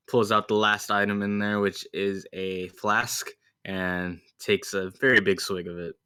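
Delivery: usual speaking voice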